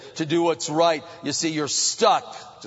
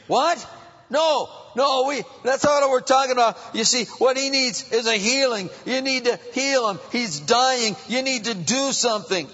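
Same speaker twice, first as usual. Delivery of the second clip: high-pitched, urgent objection; dismayed protest; 8 semitones higher than usual. Acted. Preacher acting the sick man's friends protesting to Jesus, urgent and high. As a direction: plead in disbelief, pitch raised. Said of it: "maybe his friends were thinking".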